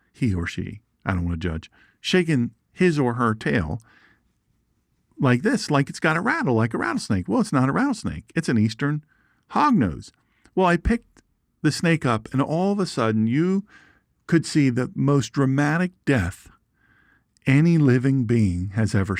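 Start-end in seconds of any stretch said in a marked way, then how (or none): none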